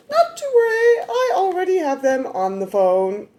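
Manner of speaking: lightly hammed breezy reply; mock breezy. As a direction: toss it off with smug cheerfulness. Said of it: "And I said".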